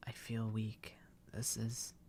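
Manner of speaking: dying whisper, barely voiced; weak whisper. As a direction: breathe it out as the life leaves you. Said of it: "someone stabbed me".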